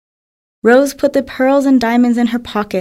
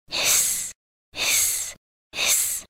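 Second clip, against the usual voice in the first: soft unvoiced snake hissing; 12 dB quieter, much slower than usual. A narrator's reading. Narrator hissing the snake's sound to listeners. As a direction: hiss softly like a snake.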